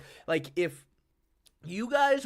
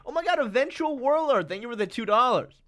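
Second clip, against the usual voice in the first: excited; 8 semitones higher than usual. Real, not acted. Reacting to a donation.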